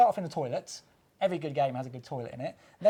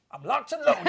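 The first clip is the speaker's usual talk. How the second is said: action hero voice; 9 semitones higher, 7 dB louder than usual. Pretend. Loading the rumble pack like gun.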